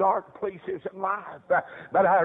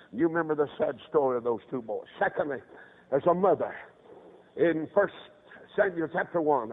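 ordinary talk

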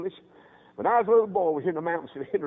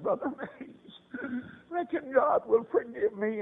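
slow, higher, broken pleading; imitated tearful plea; 7 semitones higher, slower than usual. Bereaved mother asking the visiting preacher about forgiveness, tearful plea. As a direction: act a grieving mother begging for hope.

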